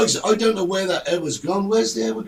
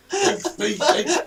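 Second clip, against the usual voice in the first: sign-off breaking into real laughter; laughing; 9 semitones higher than usual. Real, not acted. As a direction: crack up while giving the outro. Host cracks up during sign-off.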